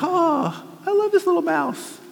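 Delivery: high, cooing gush over a cute mouse. Retold or imitated. Viewer gushing at the nature video, high and cooing. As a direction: coo like a smitten viewer. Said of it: "and you're like".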